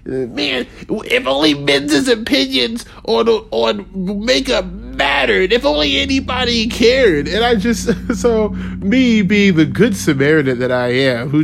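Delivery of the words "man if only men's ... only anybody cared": higher, slow, sneering mock whine; sarcastic high mock voice, drawn out on makeup; mocking screechy feminist. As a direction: parody a whiny online feminist. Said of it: "those screeching feminists".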